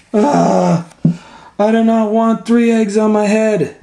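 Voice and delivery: high, loud complaint; upset protest. Acted. Elephant refusing three eggs on his head, high loud protest.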